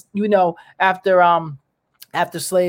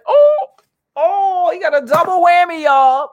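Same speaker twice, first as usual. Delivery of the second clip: very high, louder hammed-up wail; mock shock; 11 semitones higher, 5 dB louder than usual. Telling y'all, hammed-up shocked wail. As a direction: wail it like the news floored you.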